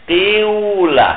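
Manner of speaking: قیولا drawn out very slowly, the kasra on ق tinted toward u; ishmam demo. Shown as a demonstration. Teacher showing students ishmam, slow and deliberate. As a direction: stretch قیولا slowly, hinting the u sound on the first vowel. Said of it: "ضمے کی طرف اشارہ کرتے ہیں".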